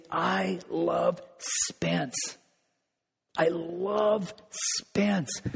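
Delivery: very slow and drawn out, softened; focused tender love. Pretend. God speaking love to Spence by name, slow and tender. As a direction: dwell lovingly on the name. God naming one person.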